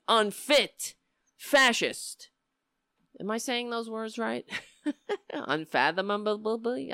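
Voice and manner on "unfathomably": laugh, then garble the end of "Unfathomably"; tongue-tied. Real, not acted. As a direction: stumble into babble on the tail of "Unfathomably". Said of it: "I can't even talk".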